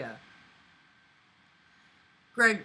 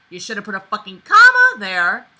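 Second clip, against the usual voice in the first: loud exasperated outburst at the writer; frustrated yell; 4 semitones higher, 15 dB louder than usual. Real, not acted. Reader yelling at the fanfic author about a missing comma, exasperated. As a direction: blast the bad punctuation.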